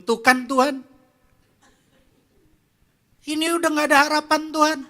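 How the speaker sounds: retold angry outburst. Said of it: "dalam keadaan marah saya bilang".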